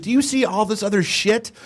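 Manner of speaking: mock indignation. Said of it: "He's like".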